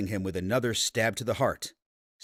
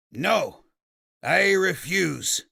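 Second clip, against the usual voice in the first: higher, louder, drawn-out shout; shouted refusal; 8 semitones higher, 6 dB louder, much slower than usual. Acted. Fawkes defying the old man, higher, louder shout. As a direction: grimace and shout it out. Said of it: "Fawkes grimaced and shouted out".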